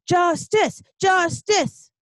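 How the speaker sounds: loud, high chant for justice; tantrum-like protest. Acted. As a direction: pound out each cry like a protester. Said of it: "a little kid throwing a tantrum".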